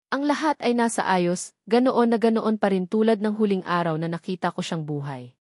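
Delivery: ordinary delivery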